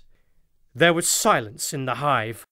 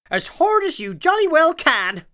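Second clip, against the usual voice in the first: hearty captain; 13 semitones higher, 5 dB louder than usual. A narrator's reading. Captain urging a sneeze.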